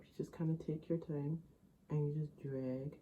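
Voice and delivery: quiet, held-breath concentration; holding breath. Real, not acted. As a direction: speak while holding your breath. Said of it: "I'm, like, holding my breath".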